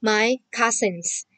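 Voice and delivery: very slow and distinct; a teacher showing children how to read the answer; slow model reading. Shown as a demonstration. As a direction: read it out word by word. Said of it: "Jadi bacanya".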